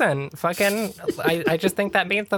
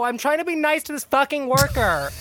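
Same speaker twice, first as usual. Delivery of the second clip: higher exasperated shout; angry yell; 9 semitones higher than usual. Acted. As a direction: yell back over your shoulder. Yelling at the car behind.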